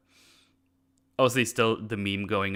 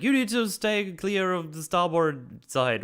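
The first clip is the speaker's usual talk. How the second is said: mock yell; 9 semitones higher than usual. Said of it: "Nebula just yells at them".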